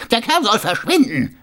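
captain's high, loud order; called out. A narrator's reading. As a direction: call out angrily to have someone removed. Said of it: "rief er". (laut und hoch gerufener Befehl)

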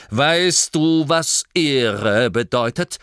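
slow, loud, rumbling rebuke; offended duke growling. Acted. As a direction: growl the word Ehre at him. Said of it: "grollte der Herzog".

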